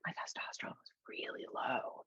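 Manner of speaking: hushed, whispered confession; very soft mock-embarrassed whisper; sheepish. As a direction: imitate a sheepish client admitting it under his breath. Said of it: "he kind of was sheepish".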